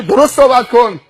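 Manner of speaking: high, loud reprimand; sharp rebuke. Acted. Roadside man scolding the insolent driver, sharp rebuke. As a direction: cut him down with authority.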